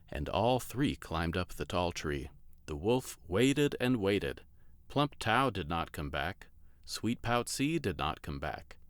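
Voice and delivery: ordinary delivery, nothing marked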